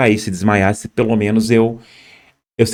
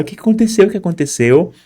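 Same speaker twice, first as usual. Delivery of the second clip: high-pitched, distraught questioning, retold; imitated tearful alarm; 8 semitones higher than usual. Woman questioning the bloodied victim, imitated tearful alarm. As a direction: mimic her upset, voice pitched well up. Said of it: "e a chorar".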